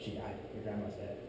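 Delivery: soft, subdued retold news; breaking bad news. Mother telling her son his grandma died, hushed bad news.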